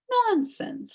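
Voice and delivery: high, sharp nonsense; scolding. A narrator's reading. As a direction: scold him out of his gloom. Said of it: "scolded the witch".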